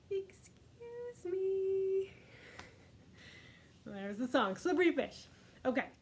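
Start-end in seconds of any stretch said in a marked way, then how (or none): 0.1-2.1 slow, higher, prim little excuse me; a dainty whale after a burp; polite whale. Acted. The teacher voicing the whale for the children. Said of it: "And then the whale says".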